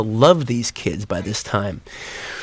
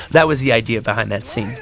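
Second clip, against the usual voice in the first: normal talking voice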